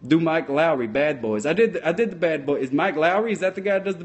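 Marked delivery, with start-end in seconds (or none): none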